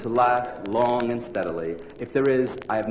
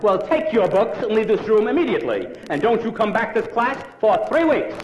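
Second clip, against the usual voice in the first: higher, sharp scolding; scolding teacher; 9 semitones higher than usual. Acted. Irritated teacher orders the boy out for weeks. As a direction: throw him out of class, fed up.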